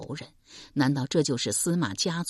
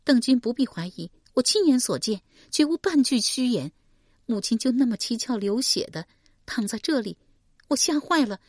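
put-on higher, grieving young woman's voice; young woman recounting her mother's murder, higher voice; young woman Shi Pei; 7 semitones higher, 3 dB louder than usual. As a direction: tell it as a wounded daughter.

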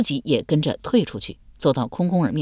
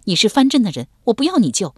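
higher, firm refusal; hard defiance; 5 semitones higher, 4 dB louder, faster than usual. A narrator's reading. Wounded Yuqing refusing help from her captive, hard defiant voice. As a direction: turn his help down stubbornly. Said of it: "玉清即是刚硬道".

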